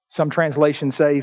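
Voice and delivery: usual speaking voice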